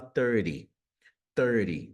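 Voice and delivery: THIRTY with the T as a tapped R; American flapped T in THIRTY. Shown as a demonstration. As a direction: say THIRTY like "thur-dee". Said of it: "o T ganha aí esse som de R".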